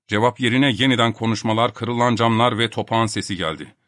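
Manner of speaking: plain reading voice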